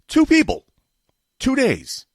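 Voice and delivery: high, loud indignant outburst; indignant shout. Real, not acted. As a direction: shout it in disbelief. Indignant about few representatives.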